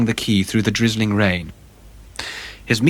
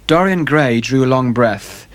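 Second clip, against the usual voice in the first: ordinary delivery, nothing marked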